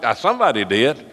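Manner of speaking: ordinary delivery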